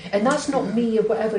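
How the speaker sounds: usual speaking voice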